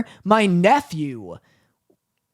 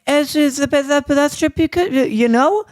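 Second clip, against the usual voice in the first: imitating a rambling toddler, pitched up; garbled kid babble in a high voice; voice going up; 7 semitones higher than usual. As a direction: let the mock child's voice climb. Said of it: "his voice goes up a bit".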